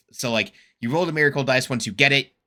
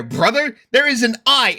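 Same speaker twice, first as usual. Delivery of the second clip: usual speaking voice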